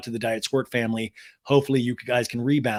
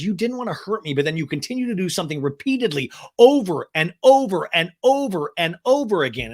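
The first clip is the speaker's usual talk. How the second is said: higher, building louder on each repeat; mock indignant scolding; 5 semitones higher, 5 dB louder than usual. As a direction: pile up the accusation, hammed up. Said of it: "Ariana goes".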